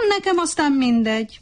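smug smile. A narrator's reading. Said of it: "fölényes mosollyal".

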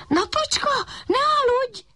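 high puppy call up to the sky; puppy calling to the sleepy sun. A narrator's reading. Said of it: "kiáltott a kiskutya".

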